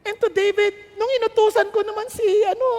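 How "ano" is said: ordinary delivery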